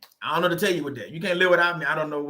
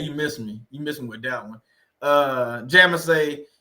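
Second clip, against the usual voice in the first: ordinary delivery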